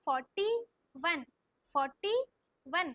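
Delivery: Forty rising, pause, then वन; slow dictation. Shown as a demonstration. Teacher models the number for pupils, slow and split. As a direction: split forty and वन with a clear gap. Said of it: "slow slow बोलो".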